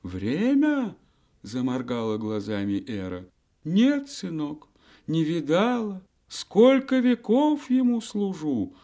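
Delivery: very high, quavering granny voice; a dotty old granny; old woman voice. Acted. Old woman Era speaks.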